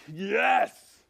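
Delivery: loud, high, drawn-out shout of relief; exultant cheer. Acted. Townsperson hearing the terror is solved, exultant shout. Townspeople's imagined joyful response.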